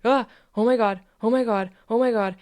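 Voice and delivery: loud, repeated gasps of acted panic; mock alarm. Coach demonstrating a scary emotion arriving, mock alarm. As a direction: act out fright at a scary feeling. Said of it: "it basically happens something like this".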